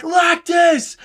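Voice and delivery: very high call of his name; anguished cry. Retold or imitated. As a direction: cry out as his friend fades away. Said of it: "he's like".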